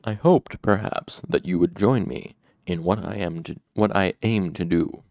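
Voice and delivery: ordinary delivery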